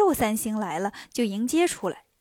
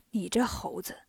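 whispered scolding; 5 semitones lower, 8 dB quieter than usual. Acted. Emperor scolds Monkey.

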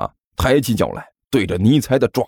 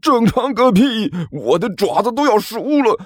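pained yelling; 12 semitones higher than usual. A narrator's reading. Burned king to his underling, high pained yelling. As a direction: cry out as your paw burns. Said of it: "尼采一边大叫".

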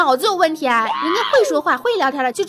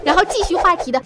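ordinary delivery

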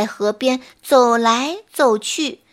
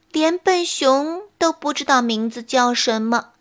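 ordinary delivery, nothing marked

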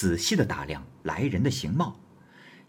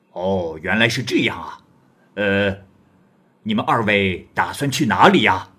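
louder, swaggering stranger voice; bold wandering hero; 6 dB louder than usual. Acted. Guest presses Li Jing about his woman, loud and swaggering. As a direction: press the young scholar like a blunt big brother. Guest asks their destination.

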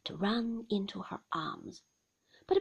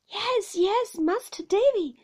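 raised, high voice of a flustered nurse; 10 semitones higher, 9 dB louder than usual. A narrator's reading. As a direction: reassure the child loudly, all in a fluster. Nurse hurriedly reassures anxious child.

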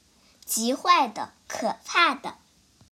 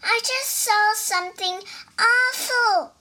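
higher, sing-song squeaky mouse voice; frightened mouse; 9 semitones higher than usual. Acted. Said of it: "cried the mouse".

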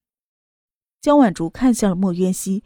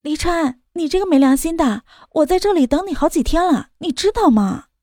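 higher, sweetly coquettish woman; 5 semitones higher than usual. A narrator's reading. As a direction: whine sweetly like a spoiled girlfriend. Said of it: "娇声道".

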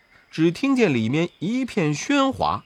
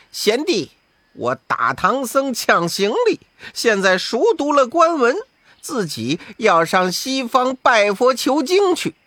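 sneering boast; 8 semitones higher than usual. Acted. Wukong boasting to Sha Seng, sneering. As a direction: gloat at the younger brother. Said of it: "悟空呵呵冷笑".

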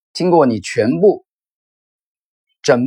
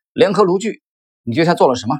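higher-pitched, mildly shouted accusation; a touch of angry outburst, pitched up at the start; narrated shout; 6 semitones higher than usual. A narrator's reading. Wolff accuses the narrator over the stock, half-shouting. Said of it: "他吼着".

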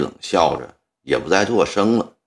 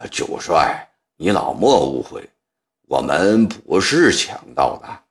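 plain reading voice

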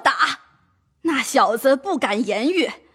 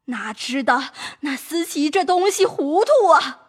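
plain reading voice

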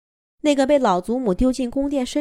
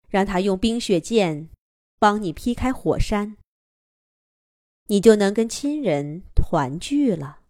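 ethereal voice. Acted. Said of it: "一个空灵的声音从天空传来".

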